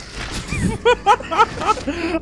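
high, loud shrieking laugh; cracking up at the scare; burst of laughter. Real, not acted. Laughing hard after the zombie scare, loud shrieks.

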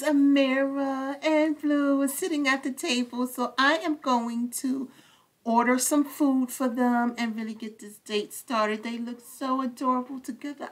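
normal talking voice

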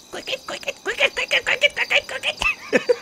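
very high squeaky chirping cricket; cricket impression. Shown as a demonstration. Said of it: "That was my impression of a cricket".

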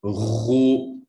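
Ghoo with a throaty gargled gh. Shown as a demonstration. Teacher urging the student to gargle the Ghoo. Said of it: "But try to gargle it still".

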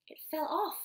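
plain reading voice

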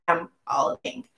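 soft, breathy whispered tag-on; hushed joking whisper; whispered aside. Retold or imitated. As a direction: drop to a sly whisper. Whispered aside in retold chat.

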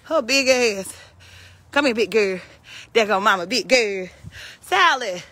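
usual speaking voice

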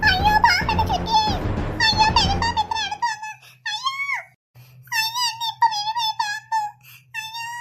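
put-on boy caught by the python, wailing in terror; terrified child. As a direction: cry out as the snake closes in. Python coils around the boy.

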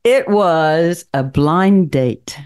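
ordinary talk, nothing marked

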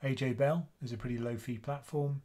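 normal talking voice

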